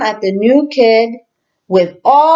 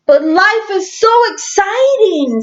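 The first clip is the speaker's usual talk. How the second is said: put-on excited kid; 10 semitones higher than usual. Clark excited in story.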